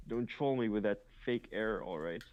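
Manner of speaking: usual speaking voice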